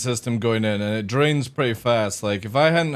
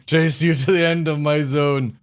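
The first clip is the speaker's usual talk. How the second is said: normal talking voice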